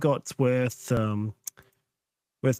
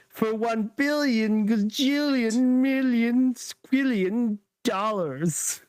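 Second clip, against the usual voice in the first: higher, slow over-the-top villain demand; 7 semitones higher, much slower than usual. Retold or imitated. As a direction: stretch the absurd sum like a cartoon villain. Villain's inflated ransom, drawn out.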